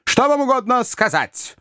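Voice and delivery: high, curt and irritated; sharp question. A narrator's reading. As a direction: snap the question out. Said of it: "резко спросил".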